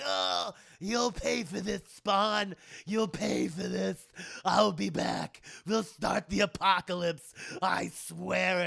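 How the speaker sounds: slow, put-on villain voice, strained threats; vengeful demon. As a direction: vow revenge like a hammy defeated demon. Beheaded demon vows revenge.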